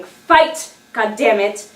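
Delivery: loud, urgent acted yelling; screaming. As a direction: scream desperate orders at your own body. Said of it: "silently screaming".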